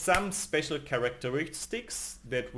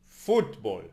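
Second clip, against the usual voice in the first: "Football" with the emphasis on Foot; stress on first syllable; 4 semitones lower, 4 dB louder than usual. Shown as a demonstration. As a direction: hit the first syllable of "Football", then fall away. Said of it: "repeat the words after me".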